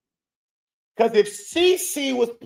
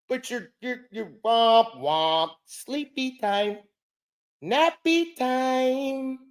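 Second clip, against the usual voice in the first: slow, drawn-out mock scolding; slow sleepy mockery; mock telling off; 3 semitones higher, slower than usual. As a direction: drag out the sleepy words mockingly. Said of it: "why you ain't told Paige".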